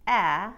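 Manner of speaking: loud short a; American short a. Shown as a demonstration. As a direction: flatten the a. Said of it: "She takes the short A".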